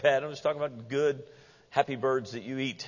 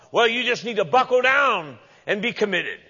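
high, loud pushy advice; mimicking a forceful scolding; mock exhorter; 7 semitones higher, 6 dB louder than usual. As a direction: bark it like a hammed-up motivator. Mocking advice to try harder.

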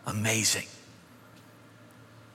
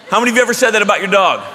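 ordinary delivery

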